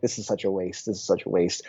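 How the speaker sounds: low, soft muttered complaint; grumbled under breath. Retold or imitated. Grumbling to himself at the cookie stand, low and soft mutter.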